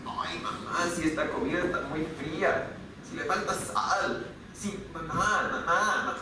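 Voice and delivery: soft, quiet mock-whiny child complaining to mom; whining child. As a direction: imitate a child nagging mamá about the food, hushed. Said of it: "A veces suena así". (niño quejándose a mamá, voz baja y quejumbrosa)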